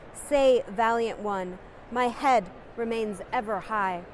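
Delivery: poetry recitation. Acted. Reciting Nazrul Islam's rebel poem to stir listeners, declaimed boldly. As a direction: proclaim each line like a rebel anthem.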